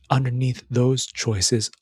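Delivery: ordinary talk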